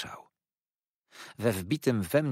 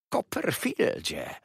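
sly hiss; 5 semitones higher, slower than usual. A narrator's reading. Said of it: "syknął wreszcie".

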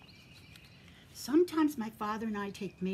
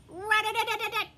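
much higher, louder rat-a-tat; woodpecker tapping; 13 semitones higher, 8 dB louder than usual. Retold or imitated. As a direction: rap it out like a woodpecker. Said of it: "and he goes".

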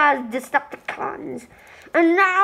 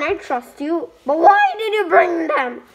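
ordinary talk

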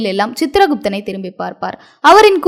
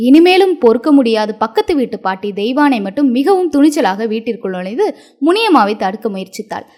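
usual speaking voice

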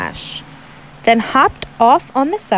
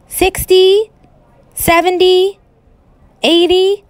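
excited counting; 9 semitones higher, 4 dB louder than usual. A narrator's reading. Child counting ants aloud.

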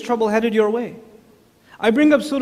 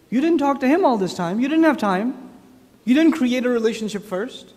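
usual speaking voice

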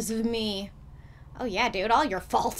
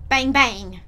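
1 mock gunshot; 8 dB louder than usual. Imitates gunshots.

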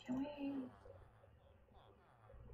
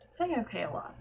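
normal talking voice

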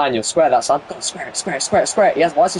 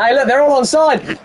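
excited call; 10 semitones higher than usual. Real, not acted. Calling out a live attack.

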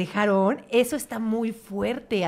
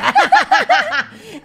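high, loud burst of laughter; big laugh; 8 dB louder than usual. Real, not acted. Risa tras la cita. (carcajada aguda y fuerte)

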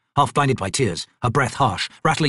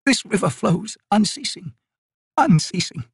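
put-on high, quavering plea of a frail old woman; desperate old woman pleading; 11 semitones higher, slower than usual. Old Maibi begging the spirits below for mercy, high desperate plea. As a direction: beg the spirits for mercy, rising to a cry.

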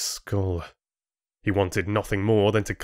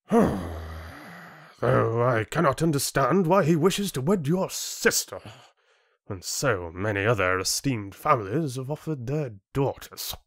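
disdainful chieftain; 5 semitones higher than usual. Acted. Chieftain baffled by son's choice of bride, disdainful. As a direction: wonder aloud with weary snobbery.

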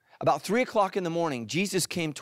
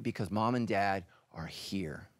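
put-on whispered, softer, reassuring a scared child; 8 semitones lower, 7 dB quieter than usual. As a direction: breathe it like a parent at bedside.

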